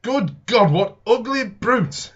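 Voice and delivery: loud, slow, horrified cry; horrified exclamation. Acted. Neighbour gaping at the Martians, slow horrified shout. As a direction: blurt it out in shock at the creatures. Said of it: "He repeated this over and over again".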